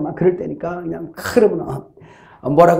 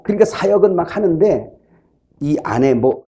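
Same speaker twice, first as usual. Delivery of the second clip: ordinary talk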